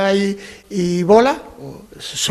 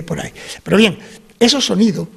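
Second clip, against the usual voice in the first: usual speaking voice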